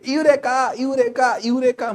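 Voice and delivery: a lighter mock shout; shouting Eureka. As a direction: call it out over and over. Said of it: "चिल्लाते हुए जाते".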